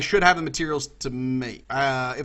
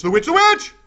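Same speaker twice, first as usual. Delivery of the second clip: high, loud alarmed cry; panicked cry; 12 semitones higher, 9 dB louder than usual. Real, not acted. Gamer spots a witch and cries out in panic. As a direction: yelp it out in panic.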